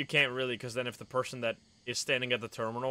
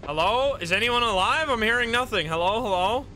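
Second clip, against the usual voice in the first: raised, higher voice calling for a reply; 7 semitones higher, 4 dB louder than usual. Real, not acted. Player hailing silent teammates on a walkie-talkie, calling out. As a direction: call out urgently into silence.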